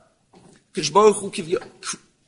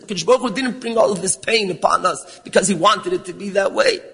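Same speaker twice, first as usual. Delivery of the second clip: ordinary delivery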